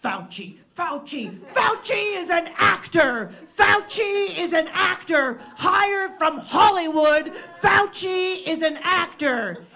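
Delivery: high, loud, slow rant; ranting conspiracy yell. Retold or imitated. Comic imitating unmasked guy ranting down the train car. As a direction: bellow it like a street preacher gone off.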